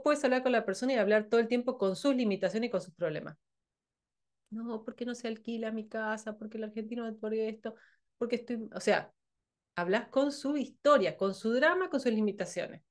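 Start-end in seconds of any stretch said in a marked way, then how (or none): 4.5-8.7 soft, muttered complaining client; mock client whine. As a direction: quietly mimic a client moaning about the house. Client complaining about their problems. (cliente quejoso imitado en voz baja)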